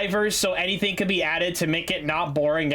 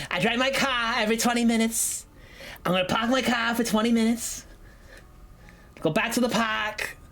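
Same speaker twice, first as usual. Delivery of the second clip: R-less Boston accent, slightly raised pitch; Boston accent demo; 4 semitones higher than usual. Shown as a demonstration. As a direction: drop every R like a Boston local. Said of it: "we don't say our R's".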